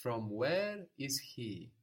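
slow, deliberate word order; slow literal gloss. Shown as a demonstration. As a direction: spell out each word slowly. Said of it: "you will ask".

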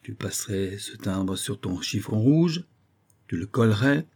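usual speaking voice

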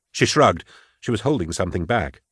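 ordinary delivery

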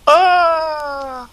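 high falsetto scream; one high yelp; mock scream. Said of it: "and then the".

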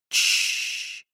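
long hushing whisper; shushing. Acted. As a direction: hush her gently. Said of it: "Я приложил палец к губам".

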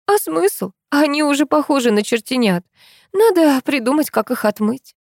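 high-pitched animated woman's voice; high-register resigned complaining; Tamara, resigned friend. Acted. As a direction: answer in a high, put-out character voice.

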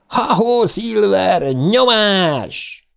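high, ringing rallying cry; exultant whoop. A narrator's reading. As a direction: spur the bike on with a yell. Bill urges the bike on.

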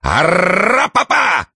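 high, slow rat-a-tat imitation; machine-gun rattle. A narrator's reading. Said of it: "пулемёт грохнул".

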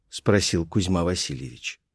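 usual speaking voice

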